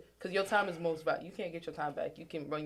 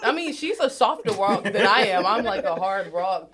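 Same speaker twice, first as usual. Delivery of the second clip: laughing admission; 4 semitones higher, 13 dB louder than usual. Real, not acted. Laughing comparison.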